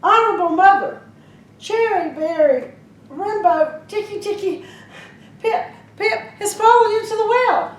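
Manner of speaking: high and loud, tumbling out; flustered, panicked. Acted. Boy blurts the garbled name to his mother, frantic.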